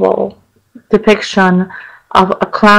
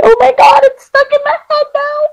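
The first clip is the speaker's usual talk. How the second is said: much higher, hammed-up scared squeal; playful terror, pitched way up; mock fright. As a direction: squeal like the image just spooked you. Scared by imagined skin-peeling villain.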